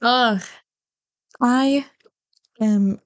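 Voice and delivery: ordinary delivery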